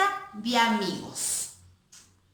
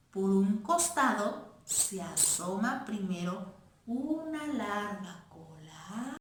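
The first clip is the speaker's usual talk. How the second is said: ordinary delivery, nothing marked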